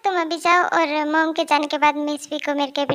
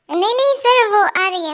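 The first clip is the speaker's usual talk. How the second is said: high, loud rush of denial; jumpy, raised-pitch reassurance; hasty flustered denial; 4 semitones higher, 5 dB louder than usual. Acted. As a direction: blurt out a cover-up to the boss. Covers for late colleague to boss.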